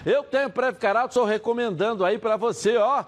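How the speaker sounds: upbeat radio ad read. Acted. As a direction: sell it like a radio spot. Host reads live ad.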